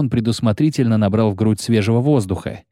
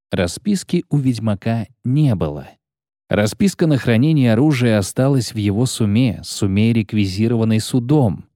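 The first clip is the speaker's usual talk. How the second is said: usual speaking voice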